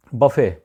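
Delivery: "بفے" as BUH-fay, silent t; short "uh" first in "بفے"; correct pronunciation. Shown as a demonstration. Teacher introduces the word buffet, correct pronunciation. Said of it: "اس کا اصل تلفظ ہے".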